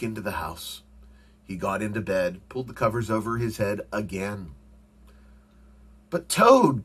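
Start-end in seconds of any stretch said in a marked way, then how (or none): 6.1-6.8 high, loud protest; crying out. A narrator's reading. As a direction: cry out in protest to a friend. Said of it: "cried Frog".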